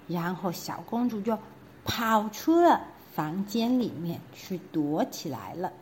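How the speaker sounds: plain reading voice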